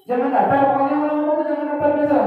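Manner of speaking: high, loud, fast insistence; fast high-pitched pep talk; high energetic urging. Shown as a demonstration. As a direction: push them not to sound flat. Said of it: "Intonasi itu penting".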